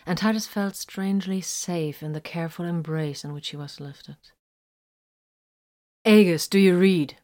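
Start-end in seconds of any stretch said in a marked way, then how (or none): 6.0-7.2 raised voice, calling over comms; sending out a call. A narrator's reading. Vox hailing Aegis over the comms, calling out. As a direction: call out firmly for a radio response. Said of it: "Vox sent out".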